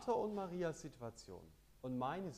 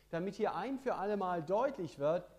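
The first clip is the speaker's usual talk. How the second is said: predigen; 6 semitones higher, 9 dB louder than usual. Acted. Predigt mit voller Kraft.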